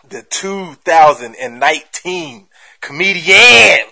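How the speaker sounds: slow, drawn-out introduction; stage announcer. Real, not acted. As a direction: announce the title with a big build-up.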